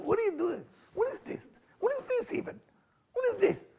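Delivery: high, incredulous put-on voice; high outraged mock voice; mock indignant squeak. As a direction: squeal it in disbelief.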